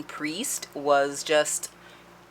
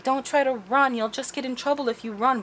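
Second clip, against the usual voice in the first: put-on high child voice; 10 semitones higher than usual. Kid voiced in retelling.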